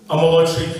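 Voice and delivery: normal talking voice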